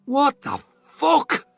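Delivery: high, slow indignant splutter; shocked spluttering. A narrator's reading. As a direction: choke out the outrage. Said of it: "spluttered Magwir".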